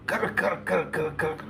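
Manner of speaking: rapid string of rasped rattle noises; imitated car rattle. Bystander describing the car's noise, imitated rattle. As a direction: mimic a buzzing, rattling car. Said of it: "It's all we hear".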